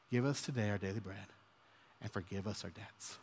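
softly reciting the prayer to God. Acted. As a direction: drop into a quiet reverent prayer. Quoting the Lord's Prayer.

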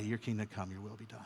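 softly reciting the prayer to God. Acted. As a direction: drop into a quiet reverent prayer. Quoting the Lord's Prayer.

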